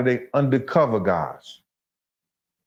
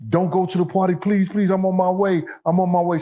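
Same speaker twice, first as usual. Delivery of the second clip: imitating a pleading hanger-on, higher pitch; mock begging; 7 semitones higher than usual. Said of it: "He was the one used to beg".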